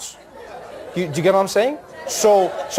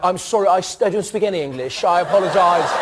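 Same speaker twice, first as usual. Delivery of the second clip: mock foreigner in perfect English; too-fluent English apology; 3 semitones lower, 4 dB louder than usual. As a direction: apologize in over-proper English. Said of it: "the equivalent of meeting".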